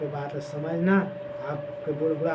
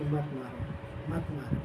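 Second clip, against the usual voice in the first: softer, hushed order to stop; soft command; 3 semitones lower, 11 dB quieter than usual. Retold or imitated. God telling the destroyer not to kill, hushed. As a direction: gently call off the killing.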